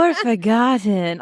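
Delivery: slow, drawn-out celebrity impression; Jennifer Coolidge. Coach imitating the student's ending, half-serious slow impression. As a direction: stretch it slowly in a rough star impression. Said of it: "sounded like Jennifer Coolidge a little bit".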